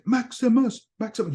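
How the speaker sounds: high, loud movie shout of a name; Gladiator impression. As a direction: call the name like the film scene. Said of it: "Where he said".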